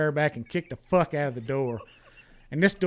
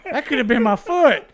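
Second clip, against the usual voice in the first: laughing disbelief; 8 semitones higher, 7 dB louder than usual. Retold or imitated. Shocked at shooting near own foot.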